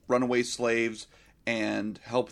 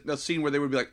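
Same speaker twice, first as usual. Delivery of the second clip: ordinary talk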